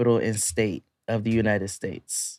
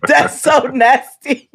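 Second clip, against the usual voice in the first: disgusted; 12 semitones higher, 4 dB louder, slower than usual. Retold or imitated. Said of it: "I was like".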